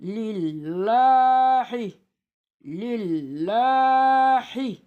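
"Lillahi" chanted slowly with a light, front "llaa"; thin lam recitation. Shown as a demonstration. Teacher showing the esre rule, "Lillahi" with thin lam. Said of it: "İnce oldu".

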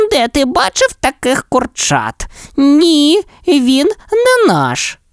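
scoffing hens; indignant hens. A narrator's reading. Hens refuse the chick, high and huffy. Hens' voice.

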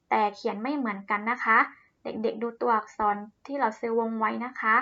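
ordinary talk

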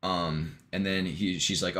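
usual speaking voice

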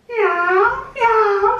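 high mewing, rising at the end; comic meowing; cat meow imitation. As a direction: mew like a cat. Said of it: "said the cat".

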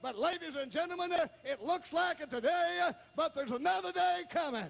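high, driving revival preacher; full-force preaching. Real, not acted. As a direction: preach it high with punched phrases.

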